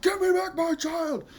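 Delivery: high, loud desperate scream; all-out theatrical plea, far above normal pitch; full-out anguished cry. Shown as a demonstration. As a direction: scream it at full power like a parent demanding a kidnapped child. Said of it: "do you need to go full out".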